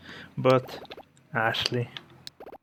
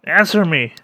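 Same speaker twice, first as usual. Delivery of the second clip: higher, louder shouted challenge; loud demand; 6 semitones higher, 8 dB louder than usual. Acted. Character yelling at a stranger to answer, loud. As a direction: call out at the intruder, demanding.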